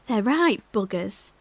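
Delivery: ordinary delivery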